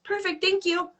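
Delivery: high, polite sing-out without added volume; breezy thanks. Retold or imitated. Bluffing past terminal staff as if she belongs, breezy and high. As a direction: toss off a high, easy thank-you.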